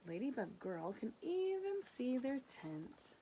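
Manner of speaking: ordinary delivery, nothing marked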